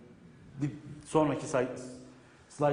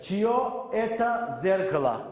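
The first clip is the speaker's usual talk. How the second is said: slow model sentence. Shown as a demonstration. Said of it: "O ile bitiyor arkadaşlar".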